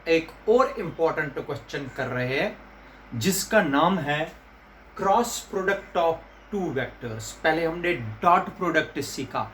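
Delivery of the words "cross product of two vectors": very slow, word by word; slow dictation. Shown as a demonstration. Teacher dictating the term slowly to students. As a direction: spell it out slowly for the class. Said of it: "teacher slowly dictates key phrase".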